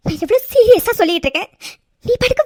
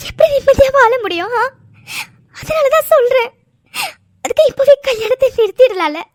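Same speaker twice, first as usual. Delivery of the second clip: crying, higher pleading voice; tearful pleading; 4 semitones higher than usual. Acted. Sobbing bride begs the groom to let her go. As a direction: beg through sobs to be let go.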